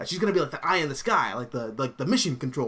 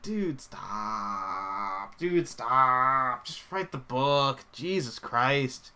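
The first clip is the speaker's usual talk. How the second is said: mock exasperation; much slower than usual. Pleading with a comics writer to stop, exasperated. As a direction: drag it out, fed up.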